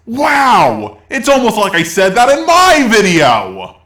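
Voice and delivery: high, loud sarcastic astonishment; mock amazement. YouTuber mocking a commenter's obvious point, sarcastic amazement. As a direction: exclaim as if stunned by the obvious.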